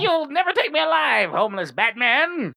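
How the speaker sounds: high defiant movie-villain yell; hammed-up villain cry. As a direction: ham it up like a cornered bad guy.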